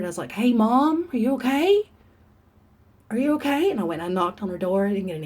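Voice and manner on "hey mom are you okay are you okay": louder, higher call through the house. Retold or imitated. Daughter calling to her mom through the house, raised worried call. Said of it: "I hollered".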